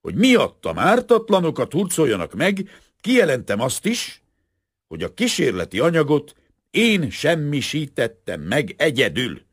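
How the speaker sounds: high, loud, resolute declaration. A narrator's reading. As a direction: proclaim it forcefully, like a statement of conscience. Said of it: "emelt hangon".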